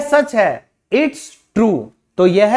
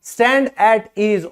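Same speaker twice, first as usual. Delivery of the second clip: slow demo; much slower than usual. Shown as a demonstration. Teacher repeating the command for students, very slow. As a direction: Stand at ease said very slowly. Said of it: "तो क्या बोला जाता था?".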